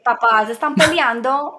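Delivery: put-on loud, slow child's complaint; little child voice. Toddler telling arguing parents, loud child voice. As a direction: call it out like a small kid.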